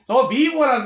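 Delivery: loud, accusing retold shout; 크게 꾸짖듯 외쳐. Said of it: "les dijo".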